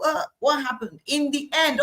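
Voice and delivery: ordinary talk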